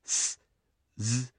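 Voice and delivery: "す、ず" as bare hissed s and buzzed z sounds, very soft; isolated consonant sounds. Shown as a demonstration. Teacher showing the ending sounds to learners: soft, isolated "す" and "ず". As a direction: breathe out "す" unvoiced, then "ず" with voice, very softly. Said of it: "一般動詞の語尾の発音が".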